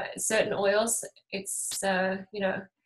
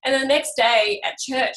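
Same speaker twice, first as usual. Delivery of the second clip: usual speaking voice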